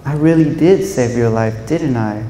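usual speaking voice

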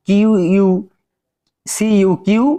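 "সিইউকিউ" অক্ষর ধরে ভেঙে বলা; "কিউ"-এর লম্বা ইউ ধ্বনি স্পষ্ট করা; ইউ উচ্চারণ দেখানো. Shown as a demonstration. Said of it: "ইউ উচ্চারণ করতে হবে". (বানান করে "কিউ" টেনে উচ্চারণ)

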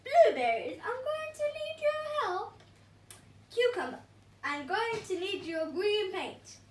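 high, loud commanding calls; rally the team; lightly voiced commanding hero; hero giving orders.

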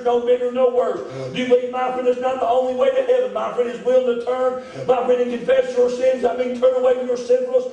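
full-force preaching. Acted. Preacher urging the congregation to confess, at full force.

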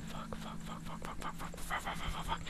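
rapid whispered curses; breathless hushed panic; panicked whispered swearing. Real, not acted. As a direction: whisper fuck over and over in panic. Panicking quietly in tense fight.

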